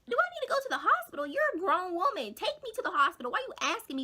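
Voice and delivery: high-pitched, exasperated acted rant; indignant retort. Answering friend's mom about the hospital, indignant. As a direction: rail at a clueless adult, hammed up.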